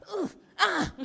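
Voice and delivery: mock pained ugh-ah over each bump; pained groans. Partner groaning in the car at every bump. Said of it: "he cried".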